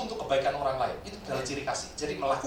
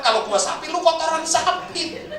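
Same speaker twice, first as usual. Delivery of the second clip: raised, higher retort with slowed ending; angry retort again; 10 semitones higher, 6 dB louder than usual. Retold or imitated. Innova driver retelling his insult to the truck driver. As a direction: throw the insult back a bit less fiercely. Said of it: "Maka dia bilang".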